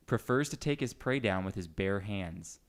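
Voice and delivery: usual speaking voice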